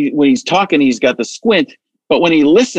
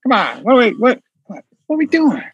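higher, fast jumbled Biden gibberish; Biden impression; 8 semitones higher, much faster than usual. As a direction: rattle it off then a breathy come on. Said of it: "throw 'em all together".

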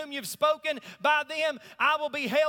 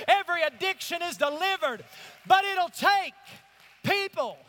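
preaching at full force; 3 semitones higher, 3 dB louder than usual. Real, not acted. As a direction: climb into a rousing sermon peak.

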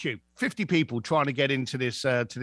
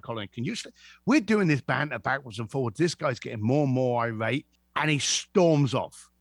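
ordinary delivery

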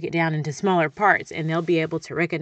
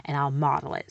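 ordinary talk

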